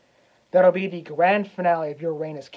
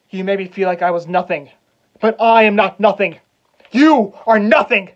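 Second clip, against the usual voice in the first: furious, full-voiced threats, pitch raised; enraged shouting; 3 semitones higher, 9 dB louder than usual. Hamlet threatens the uncle who killed his father, enraged shout. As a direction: shout it at him in a vengeful rage.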